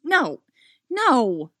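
loud, high, emphatic denial; emphatic refusal; firm. A narrator's reading. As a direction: deny it firmly, word by word. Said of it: "she says firmly".

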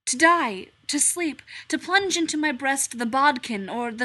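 loud theatrical verse; declaiming Hamlet. Acted. Hamlet declaiming to the stranger, loud and theatrical. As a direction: proclaim it from the stage.

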